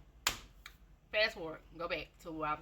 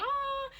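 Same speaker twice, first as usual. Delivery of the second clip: short high squeal; quick pained child's cry; mock kid scream; 4 dB louder than usual. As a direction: yelp like a hit kid. Kids scream when toy hits.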